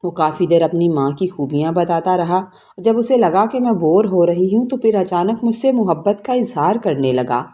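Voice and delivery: ordinary delivery, nothing marked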